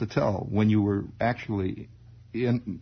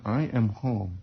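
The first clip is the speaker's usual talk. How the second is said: normal talking voice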